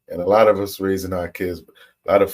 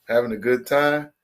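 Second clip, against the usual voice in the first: usual speaking voice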